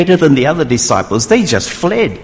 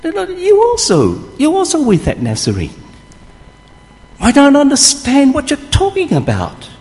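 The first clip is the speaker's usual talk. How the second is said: high voiced accusing maid; servant girl; 12 semitones higher than usual. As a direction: point the finger as a young servant girl. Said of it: "a servant girl".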